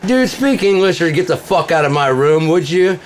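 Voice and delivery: high, loud taunting rant; mocking yell. Shouting back at the troll in his game room. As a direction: yell the insult back at him. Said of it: "I'm like (from 77)".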